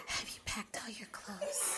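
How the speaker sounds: whispering. Pretend. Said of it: "You talk to me though".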